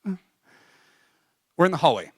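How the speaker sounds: usual speaking voice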